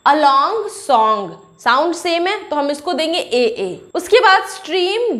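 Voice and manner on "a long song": very slow, drawn-out reading of the words; reading rhyme words. Shown as a demonstration. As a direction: stretch each word so the rhyme is heard. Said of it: "last दो words आप देखिए".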